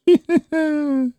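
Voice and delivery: put-on high ringing childish laugh; childlike laughter. Kalganov laughing at Maksimov's story, childlike peals. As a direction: burst into helpless boyish laughing. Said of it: "залился самым детским смехом".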